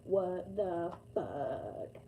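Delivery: a hint of dad, very slow and drawn out; lightly imitating dad's slow disbelief. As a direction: stretch What the fuck slowly like dad. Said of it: "That's exactly how my dad says it".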